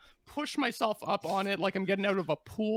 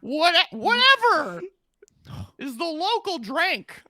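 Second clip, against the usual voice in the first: high, loud goblin listing drinks; 9 semitones higher, 5 dB louder than usual. Acted. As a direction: rattle off drinks eagerly.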